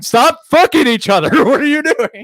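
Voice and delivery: high, loud exasperated yell; mock yell. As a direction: shout at the TV through laughter. Yelling at reality show cast.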